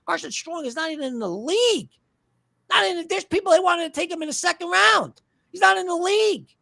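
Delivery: can't believe anyone wanted him; exasperated disbelief. Real, not acted. Host mocking fans who wanted Carson Strong, exasperated.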